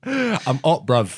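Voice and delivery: put-on London street voice; mock London accent. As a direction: toss it off like a London roadman. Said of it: "doing some Top Boy shit".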